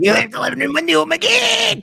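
high scolding wife voice; mock angry wife. Wife scolding him for the open window, high. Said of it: "That's like my wife".

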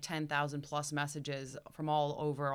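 ordinary talk

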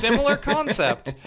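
high, loud laughing words. Real, not acted. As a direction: laugh through it. Laughing reply after shared joke.

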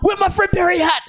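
frantic high whine. Retold or imitated. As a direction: whine it like a frazzled organiser. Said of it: "He's like".

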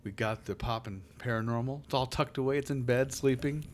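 soft, hushed scene setting; lightly hushed storyteller voice; hushed creepy legend telling. Acted. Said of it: "You're setting the scene".

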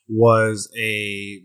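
usual speaking voice

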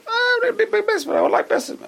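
high-pitched gushing wisher; mock excitement. Congregant gushing about remodeling the basement, mock excitement.

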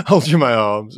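normal talking voice

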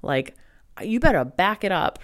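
retold yell at a ghost in the night; mock yelling at ghost. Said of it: "yelling in my room".

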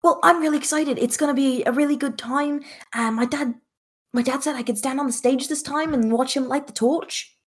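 put-on excited, high-pitched kid voice; acted eager excitement; Remus. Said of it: "Remus looks excited and goes".

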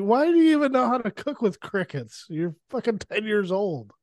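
high-pitched incredulous questioning; mock disbelief. Incredulous reaction to the kid.

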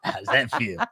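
soft, laughing tease; playful low tease to a sleepy partner; soft mock-proud purr; teasing. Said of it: "I was like".

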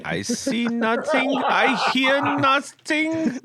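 high slow comic denial; mock Schultz voice. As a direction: play dumb in a silly high voice. Mock Sergeant Schultz line.

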